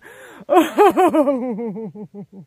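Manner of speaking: loud startled laugh; laughing off the shock; loud real laugh. Real, not acted. Laughs after the bug.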